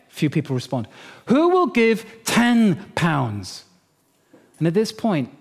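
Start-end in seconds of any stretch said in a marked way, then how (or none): 1.3-3.6 high, slow call for pledges; preacher's appeal. Retold or imitated. Father asking the church who will give, high drawn-out appeal. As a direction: pitch it up and stretch out the amount.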